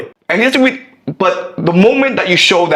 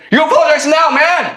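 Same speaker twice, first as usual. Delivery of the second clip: high-pitched mock outburst; acted angry demand; 11 semitones higher, faster than usual. Bully insisting on an apology, acted anger. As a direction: demand it, pitch jumping right up.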